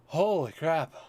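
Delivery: startled. Real, not acted. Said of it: "That was a legitimate reaction".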